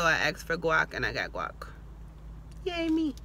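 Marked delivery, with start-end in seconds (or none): 2.6-3.1 self-cheer. Real, not acted. Self-congratulation.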